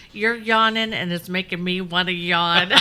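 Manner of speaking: laughing through the words; giggling while talking. Real, not acted. Laughing at guest's yawn.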